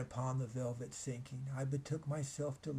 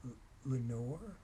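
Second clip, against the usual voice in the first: echo murmur. A narrator's reading. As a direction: let it come back like a far-off echo. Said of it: "an echo murmured back the word".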